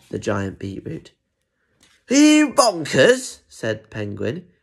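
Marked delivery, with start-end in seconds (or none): 2.1-3.4 high, loud, drawn-out disbelief; incredulous. Acted. Penguin to Zebra eating giant beetroot, incredulous.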